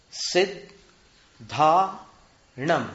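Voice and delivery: सिद्धाणम stretched very slowly, syllables apart; सिद्धाणम, counted out syllable by syllable; syllable counting. Shown as a demonstration. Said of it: "एक, दो, तीन". (सिद्धाणम धीरे-धीरे अक्षर गिनते हुए)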